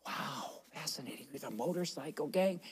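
soft, awed group reaction; imitated hushed fascination. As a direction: hush it right down in mock awe. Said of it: "and they were all, 'Wow".

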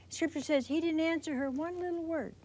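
ordinary talk, nothing marked

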